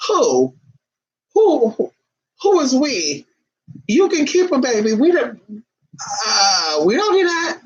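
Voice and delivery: indignant. Acted. Indignant reply to neighbor.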